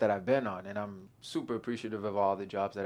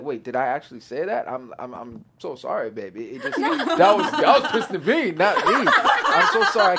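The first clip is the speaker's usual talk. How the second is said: high, loud, giggling apology; laughing sorry to his fiancée; laughing mock apology; 5 semitones higher, 9 dB louder than usual. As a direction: apologize while cracking up.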